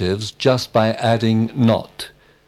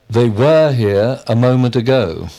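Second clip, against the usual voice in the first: "were" stressed with emphasis; 4 dB louder than usual. Shown as a demonstration. Student answering with an emphatic example, stress on "were". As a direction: punch the word "were". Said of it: "when we wish to be emphatic".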